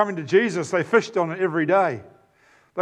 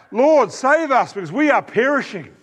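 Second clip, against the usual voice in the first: higher, louder desperate plea; frightened cry for help; 6 semitones higher, 3 dB louder than usual. Acted. Disciples in the storm crying out to Jesus, desperate and afraid. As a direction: cry out to the Lord in terror.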